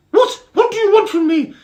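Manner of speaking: very high, loud squeaky voice; frightened high ladybird. Acted. Mister Ladybird confronted by the beetles, frightened squeak. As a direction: squeak out in alarm.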